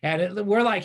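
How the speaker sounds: ordinary talk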